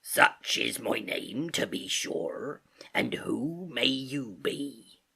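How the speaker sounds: slow, husky sailor drawl; Long John Silver. Acted. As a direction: draw it out like an old seafarer. Silver voiced as a character.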